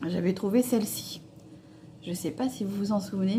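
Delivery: ordinary talk, nothing marked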